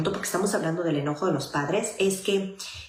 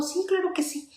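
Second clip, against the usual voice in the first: put-on child's eager yes; 10 semitones higher than usual. Child's eager reply voiced in story.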